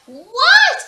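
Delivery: high, loud querying what; insistent what. A narrator's reading. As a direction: push back. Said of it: "Ada queried".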